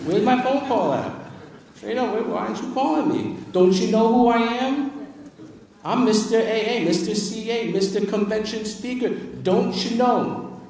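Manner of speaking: high, loud, self-important outrage; indignant rant. Retold or imitated. Speaker mocks himself over a missed call-back, high indignant rant. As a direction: demand answers like an offended big shot.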